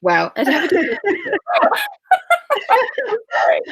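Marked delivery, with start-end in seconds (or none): 1.0-3.3 crying laugh. Real, not acted. Author overcome, laughing through tears, crying laugh. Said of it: "My eyes don't know what to do with mascara".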